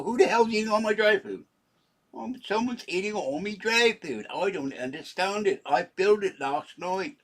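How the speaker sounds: comic high cat fussing over its food; cat's voice. Pretend. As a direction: complain about the empty bowl as the cat. Voicing the cat at its bowl.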